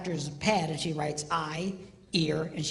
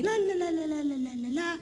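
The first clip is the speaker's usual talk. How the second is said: wife's la-la chatter; 8 semitones higher than usual. Retold or imitated. Wife chattering.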